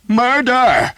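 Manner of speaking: very high, loud desperate scream; shrieking murder. A narrator's reading. Pinned archer shrieking for help, desperate scream. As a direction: scream for your life. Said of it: "he screamed".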